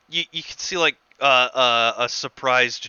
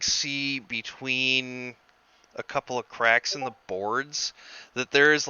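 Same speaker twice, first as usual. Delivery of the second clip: ordinary talk, nothing marked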